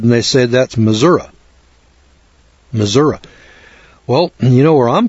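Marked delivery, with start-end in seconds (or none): 0.4-3.2 Missouri said as "mi-ZUR-uh"; locals' correction. Retold or imitated. Offended locals correcting a newcomer, Missouri as "Mizzourah". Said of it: "they get kind of offended".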